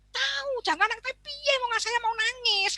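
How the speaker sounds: put-on high, whining child's protest; whiny child. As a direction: whine like a child who must cry.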